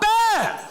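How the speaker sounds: sudden loud high-pitched "bam"; imitated bang. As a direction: burst out with a jolting bang. Imitating bus lurching to stop.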